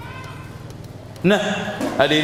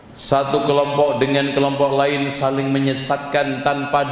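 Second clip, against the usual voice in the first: fiery preaching; 8 semitones higher than usual. Acted. Preacher rousing the congregation about music, full-force preaching. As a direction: preach it hard to the crowd.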